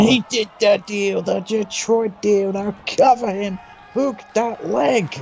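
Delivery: excited play-by-play calling; wrestling commentator. Acted. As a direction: call the action live, rising hype.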